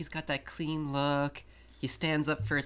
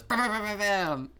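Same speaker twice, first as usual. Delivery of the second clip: higher-pitched imitation of rapid gunfire; mock gunfire; 6 semitones higher than usual. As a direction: fire off a play gun with your mouth. Imitates crutch gun firing.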